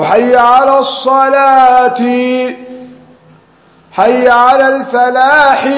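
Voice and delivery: حي على الصلاة and حي على الفلاح chanted slow and high, ending on a vowel; stop with vowel. Shown as a demonstration. Teacher demonstrating a wrong stop in the adhan, slow chant. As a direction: chant each phrase slowly, keeping the final vowel. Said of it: "أو".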